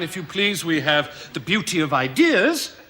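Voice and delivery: ordinary talk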